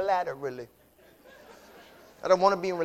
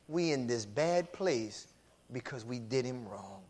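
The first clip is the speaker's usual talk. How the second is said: remorseful brothers; 7 semitones lower, 8 dB quieter than usual. Retold or imitated. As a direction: quietly admit the wrong, head bowed. Brothers realizing guilt, voiced.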